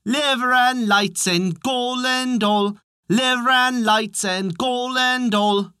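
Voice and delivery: high, slow bawled chant; drawn-out high cry without much extra volume; singsong bawling. A narrator's reading. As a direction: bawl it out as a droning refrain. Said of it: "bawling out".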